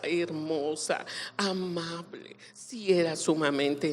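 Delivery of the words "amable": ordinary talk, nothing marked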